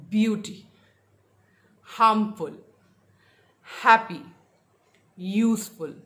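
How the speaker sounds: BEAU-ty, HARM-ful, HAP-py, USE-ful, loud first beat; word-list demo with the opening syllable of beauty, harmful, happy, useful hit hard; first-syllable stress. Shown as a demonstration. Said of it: "The stress was on the first syllable".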